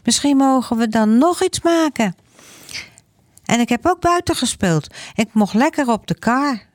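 put-on high, quick little boy's voice; excited child. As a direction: tell mum eagerly like a small boy. Boy tells mum about school. (hoge, snelle kinderstem)

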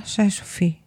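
ordinary delivery

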